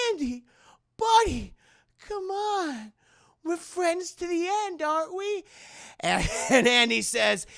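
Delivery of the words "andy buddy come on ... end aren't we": high, wheedling doll voice; Chucky. Acted. Doll begs Andy as a friend, high wheedling voice. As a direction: plead sweetly, pausing between phrases.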